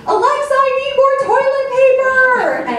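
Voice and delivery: put-on a small girl hollering from the bathroom; child calling out. Mom voicing daughter shouting to Alexa from the bathroom, high slow yell. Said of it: "in her bathroom screaming".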